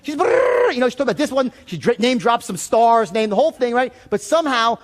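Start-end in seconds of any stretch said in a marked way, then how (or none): 0.2-0.7 high brrr of fast chatter; rapid babble noise. Retold or imitated. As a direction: trill a quick brrr for her chatter. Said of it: "I couldn't understand a word she said".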